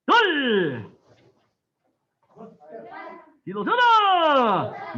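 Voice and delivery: loud Korean count, each number punched out; shouted drill count. Real, not acted. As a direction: call every count like a drill leader.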